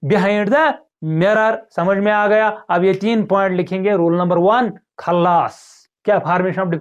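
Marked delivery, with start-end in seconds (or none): 0.0-1.6 slow emphasis. Shown as a demonstration. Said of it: "mirror के पीछे".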